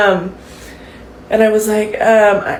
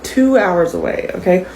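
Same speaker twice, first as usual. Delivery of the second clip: normal talking voice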